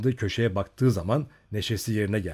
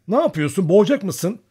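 higher, louder startled scolding; snatch the baby back, alarmed; alarmed mother; 9 semitones higher, 8 dB louder than usual. A narrator's reading. (telaşlı anne, yüksek sesle azarlayarak)

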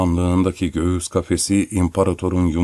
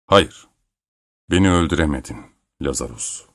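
understated resolve; determined voice; 4 semitones lower than usual. A narrator's reading. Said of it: "dedi kararlı bir sesle".

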